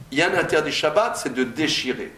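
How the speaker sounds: normal talking voice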